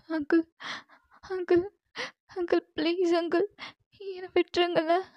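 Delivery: high, soft, trembling plea to let her go; frightened girl pleading. Acted. Captive girl begs Jimman to send her home, frightened pleading. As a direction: beg your captor like a scared young girl.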